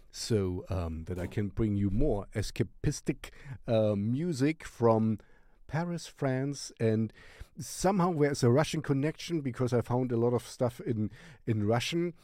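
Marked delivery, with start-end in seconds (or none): none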